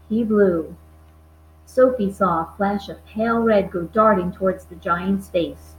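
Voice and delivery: plain reading voice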